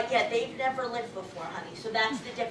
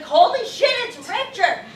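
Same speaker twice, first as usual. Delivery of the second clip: excited recognition; 8 semitones higher, 8 dB louder than usual. Retold or imitated. Recognising her spirit guide.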